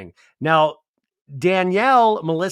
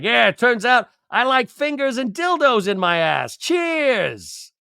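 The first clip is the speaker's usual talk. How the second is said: mock toast; 8 semitones higher than usual. [name] toasting the guys.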